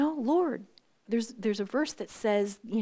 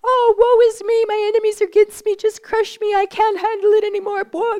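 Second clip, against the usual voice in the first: much higher and loud, a hammed-up woeful lament; wailing David; 13 semitones higher, 14 dB louder than usual. Preacher acts David's psalm lament, loud theatrical wail. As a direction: cry it out like a psalmist at the end of his rope.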